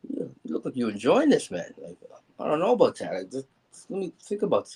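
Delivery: nervous protest. Retold or imitated. Nervous stalling before rib is broken.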